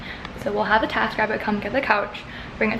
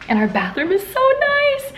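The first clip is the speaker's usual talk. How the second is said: delighted. Real, not acted. Delighted by the new bathroom.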